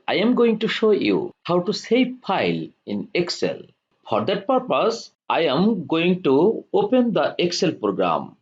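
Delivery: ordinary delivery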